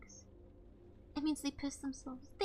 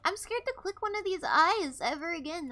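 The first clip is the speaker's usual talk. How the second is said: rattled outburst; 3 semitones higher, 9 dB louder than usual. Real, not acted. Freaked out after the scene.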